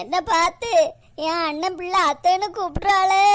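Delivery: hammed-up tearful wail; crying. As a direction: sob out the words in self-pity. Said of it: "எதுக்கு அவங்க அழுதுகிட்டே இருக்காங்க?". (அழுது புலம்பும் குரல்)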